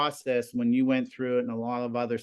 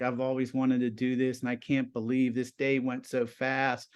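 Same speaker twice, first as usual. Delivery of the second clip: normal talking voice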